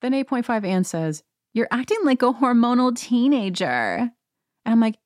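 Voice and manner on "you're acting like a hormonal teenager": high, hammed-up housewife jab; mock put-down. Recapper mimics Anne scolding at dinner, mocking put-down. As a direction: imitate her snide insult, pitched up high.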